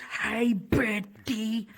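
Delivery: lightly imitated slow guy's hello; Ethan's greeting. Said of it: "He says".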